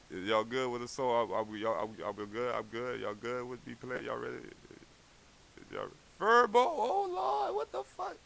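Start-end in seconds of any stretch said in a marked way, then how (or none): 6.2-8.2 high-pitched startled exclamation, trailing softer; startled outcry. Real, not acted. Performer startled by Furbo, high surprised cry. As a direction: voice shoots up in sudden surprise. Startled by someone or something appearing.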